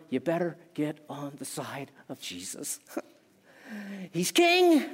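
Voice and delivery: ordinary delivery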